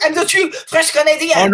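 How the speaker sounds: host teasing a guest in a mock French Canadian accent. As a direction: imitate his French Canadian accent playfully. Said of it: "made your accent thicker when you're like".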